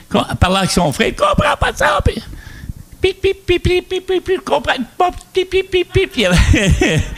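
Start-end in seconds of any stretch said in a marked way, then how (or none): 1.1-2.2 very high mock outburst; exasperated woman. Woman on the phone with her brother, exasperated. 3.0-6.1 string of high-pitched "pip" bleeps; imitated bleeps. Bleeping out the woman's angry phone rant, imitated bleeps. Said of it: "il y en avait des pip".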